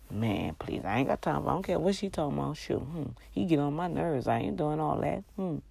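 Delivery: quiet, fast brush-off of a nagging partner; dismissive muttering. Pretend. Wife brushing off her husband, dismissive quick aside. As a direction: wave him off under your breath, rushing.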